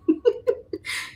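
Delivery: a high squealing laugh; high giggle. Real, not acted. Laughs at own request.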